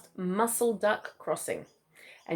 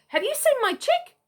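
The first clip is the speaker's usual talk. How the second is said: much higher worried voice; anxious emu dad; 5 dB louder than usual. A narrator's reading. Emu dad begging the possum for news, high and anxious.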